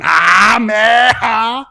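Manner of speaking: loud, strained power-up yell; Goku powering up. Retold or imitated. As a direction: roar it like a hero transforming. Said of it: "Goku going super fucking saiyan".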